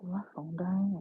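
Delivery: breathy half-whispered aside; whispering. Real, not acted. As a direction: whisper it to yourself. Low-battery aside, half to herself.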